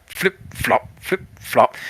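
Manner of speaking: quiet, staccato flip-flop sound effect; little slapping footstep noises. Acted. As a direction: imitate sandals slapping softly along. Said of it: "you hear the flip-flop".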